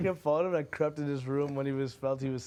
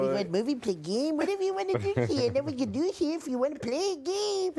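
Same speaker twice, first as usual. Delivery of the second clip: mock babysitter voice; 10 semitones higher, 3 dB louder than usual. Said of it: "I'm gonna be a babysitter".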